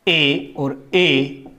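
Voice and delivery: ए held very long and slow; modelling the two vowels; drawn-out vowel demo. Shown as a demonstration. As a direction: sound out ए और ए slowly. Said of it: "ये जो बोले जाते हैं दोनों".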